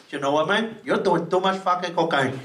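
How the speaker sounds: low gangster Scarface voice; Tony Montana. Retold or imitated. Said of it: "Tony Montana from Scarface going".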